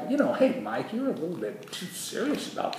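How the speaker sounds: high put-on opener; light mock advice, high voice; mock gentle chiding. Voices worldly advice to himself.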